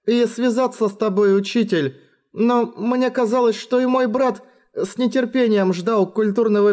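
put-on high, trembling girl, barely holding on; trembling whisper. A narrator's reading. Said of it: "дрожащим голосом прошептала Рин".

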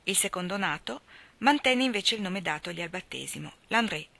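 ordinary delivery

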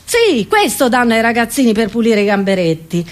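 normal talking voice

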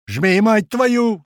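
loud, high, drawn-out command; angry yell. A narrator's reading. Man overruling the call to brake, angry yell. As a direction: bellow the order high, stretching it slowly. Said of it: "заорал Грач".

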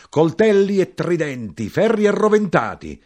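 high, loud street-crier's yell; shouting. A narrator's reading. Knife-grinder crying knives and tridents, high shout. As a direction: yell out each item. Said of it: "l'arrotino gridò".

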